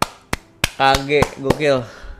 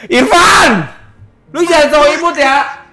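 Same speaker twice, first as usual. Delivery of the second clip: calling out; 11 semitones higher than usual. Real, not acted. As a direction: shout his name, teasing. Calling out a viewer.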